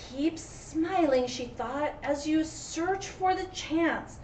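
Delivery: lively storybook read, loud cries of no; dramatic read-aloud to a class; story reading. A narrator's reading. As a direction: read the rhyme with big swings for kids.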